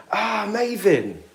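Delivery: ordinary talk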